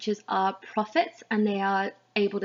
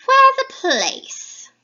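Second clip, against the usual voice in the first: very high, loud, slow screech; drawn-out high witch cry; first witch; 10 dB louder, much slower than usual. Acted. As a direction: shriek the question like a hag. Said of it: "introduce you to the three witches".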